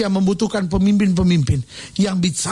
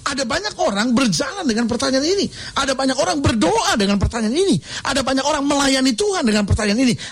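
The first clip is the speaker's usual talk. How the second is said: ordinary delivery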